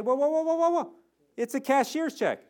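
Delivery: high-pitched string of whoas in mock alarm; alarmed protest. Customer objecting to the bank's hold on a check, alarmed protest. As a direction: halt them with an acted, high protest.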